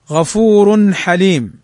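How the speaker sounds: غفور حليم slowly, tanwin nun clear before the ha; slow clear reading. Shown as a demonstration. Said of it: "تنوين بعده الحاء". (قراءة بطيئة لـ غفور حليم مع إظهار التنوين)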